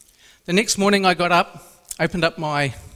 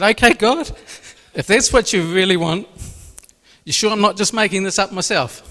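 raised, louder, pleading call to God; excited plea; 4 semitones higher, 5 dB louder than usual. Retold or imitated. Man retelling his eager answer to God, louder and higher. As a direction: call out to God, half doubting.

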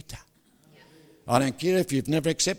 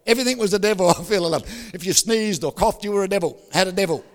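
ordinary delivery

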